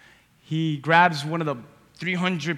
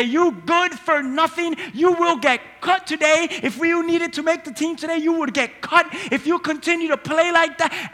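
harsh coach; 7 semitones higher than usual. Retold or imitated. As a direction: chew out the star player, pitched up. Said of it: "look at that all-star in the face and say".

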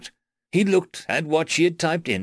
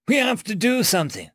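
shouted urgency; 9 semitones higher than usual. A narrator's reading. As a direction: cry out for action in a raised voice. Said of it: "shouted Bugrat".

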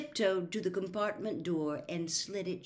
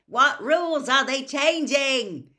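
loud, higher, slow shout; 9 semitones higher, 12 dB louder than usual. Acted. Twin shouts to his mother from the train, loud slow shout. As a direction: call it out over the train noise.

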